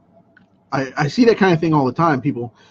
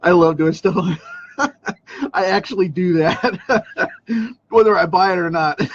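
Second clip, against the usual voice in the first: talking through giggles; laughing; 4 semitones higher than usual. Real, not acted. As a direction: laugh while confessing. Laughing at own prank.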